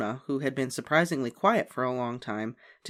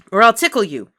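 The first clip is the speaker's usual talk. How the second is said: triumphant threat; 8 semitones higher, 13 dB louder than usual. A narrator's reading. Sister threatens.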